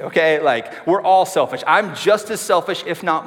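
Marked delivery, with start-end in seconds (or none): none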